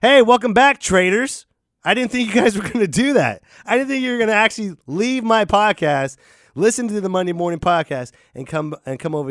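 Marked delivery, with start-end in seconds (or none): 0.0-3.1 big welcome back. Acted. Greeting returning listeners.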